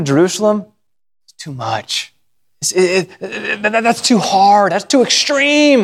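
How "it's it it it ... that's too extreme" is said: whining protest. Acted. People balking at the trip to Jerusalem, whining protest. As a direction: protest it like an overblown excuse. Acting out people's objection to travelling.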